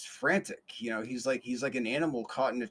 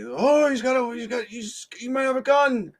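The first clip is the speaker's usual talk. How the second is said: much higher, louder frightened squeal; mock alarm, voice jumping up; imitating panicked coworkers; 12 semitones higher, 9 dB louder than usual. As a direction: coworkers freaking out about a gun, mock panic. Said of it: "so they're freaking out".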